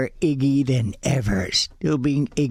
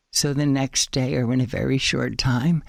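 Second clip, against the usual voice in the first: ordinary talk, nothing marked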